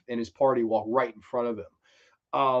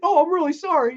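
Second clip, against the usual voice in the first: very high, loud flustered sorry; mock apology; 7 dB louder than usual. Acted apology after spilling dog food.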